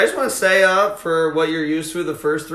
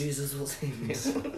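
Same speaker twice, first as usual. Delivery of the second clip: very soft, low and slow; mock pious hush; 4 semitones lower, 15 dB quieter, slower than usual. Host in a joke moment of silence, pious hush. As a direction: intone it softly like a mock prayer.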